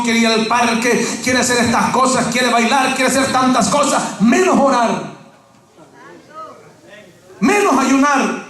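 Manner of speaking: usual speaking voice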